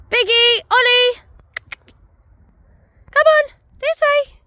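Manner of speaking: high, loud call across the field. Real, not acted. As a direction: shout the dogs' names to call them back. Calling dogs back from afar.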